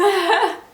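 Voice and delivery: high, loud helpless laugh; burst of laughter. Real, not acted. Mother cracking up at her own birth story, helpless laugh. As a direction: crack up out of nowhere. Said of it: "I could not help myself".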